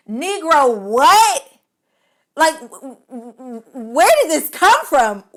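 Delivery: high, loud baffled outburst; stunned incredulous what; shocked disbelief. Real, not acted. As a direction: react as if you can't believe it. Shocked reaction to word.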